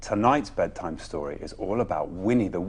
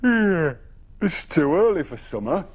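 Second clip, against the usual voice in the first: a hint of grumbly woken animals, higher and louder; grumbling animals; 7 semitones higher, 7 dB louder than usual. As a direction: grumble like grumpy sleepy creatures. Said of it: "they grumbled".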